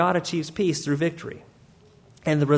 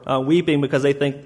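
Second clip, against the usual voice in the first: ordinary delivery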